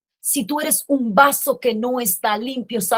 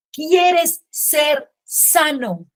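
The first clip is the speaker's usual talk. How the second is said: "¿Quieres ser sano?" drawn out very slowly, slightly higher; Jesus asking slowly; 3 semitones higher, much slower than usual. Acted. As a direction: let the question hang, very slow. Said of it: "Jesús le dice".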